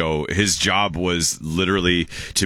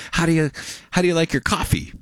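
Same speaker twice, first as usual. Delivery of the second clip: put-on old lady voice; 11 semitones higher than usual. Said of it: "And they're like".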